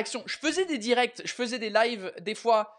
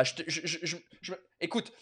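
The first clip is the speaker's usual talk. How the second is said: usual speaking voice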